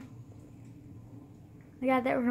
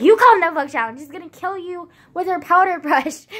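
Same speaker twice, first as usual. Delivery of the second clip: loud, higher mock yell; friend yelling back; 6 semitones higher, 13 dB louder than usual. Friend yelling back about the killer old lady, loud mock yell. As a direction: snap it right back at her. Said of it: "she was like".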